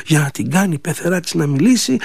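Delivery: ordinary delivery, nothing marked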